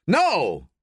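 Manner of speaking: sharp protesting shout. A narrator's reading. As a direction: bark the refusal. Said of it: "shouts Jackson".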